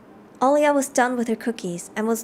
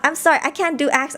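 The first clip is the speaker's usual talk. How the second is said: normal talking voice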